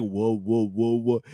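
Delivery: whoa repeated, stuck like a stutter; stuttering imitation. Shown as a demonstration. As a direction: act out getting stuck on whoa. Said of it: "it was just like".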